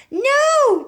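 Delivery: loud, very high no; shrill cry. A narrator's reading. Family crying out to stop Grandma, loud and high. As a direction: scream it to stop her. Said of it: "the other two cried".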